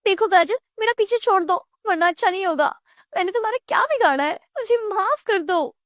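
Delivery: high-pitched, frightened pleading; warn, then beg in a high panicky voice; panicked cry. Acted. Savita sees her neighbour as a carrot, panicked high pleading. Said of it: "वो जोर से चिल्लाती है".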